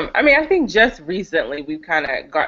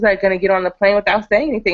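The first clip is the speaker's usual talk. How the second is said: ordinary talk, nothing marked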